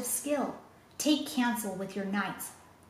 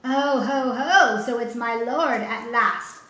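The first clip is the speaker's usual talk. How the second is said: mocking laugh; 7 dB louder, slower than usual. Tyrant mocks the pleading father.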